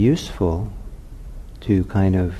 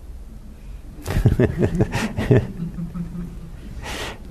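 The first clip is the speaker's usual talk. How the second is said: a soft chuckle with almost no voice; quiet laugh; 9 semitones higher, 4 dB quieter than usual. Real, not acted. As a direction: laugh to yourself under your breath. Laughing at own sleepless night.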